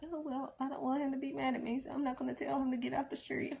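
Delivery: high, soft and fast, spineless and fretful; mock a parent too scared to correct; mock timid parent. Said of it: "I wouldn't be like".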